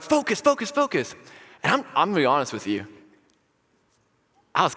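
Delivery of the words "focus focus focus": high-pitched, urgent repeated plea; urgent pleading. Retold or imitated. Youth pastor pulling distracted students back, high urgent plea. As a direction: plead for attention in a raised pitch.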